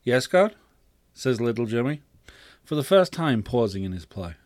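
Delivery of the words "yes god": lightly voiced child, pitched up; high, questioning answer to a parent; attentive boy. Boy pauses play to answer.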